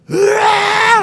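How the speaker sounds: loud high hammed-up wail; mock wailing. Pastor imitating his wife's wail, a loud hammed-up cry. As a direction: ham up the wife bursting into sobs. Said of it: "또 울기 시작했어요".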